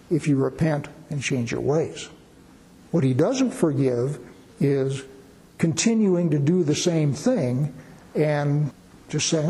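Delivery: normal talking voice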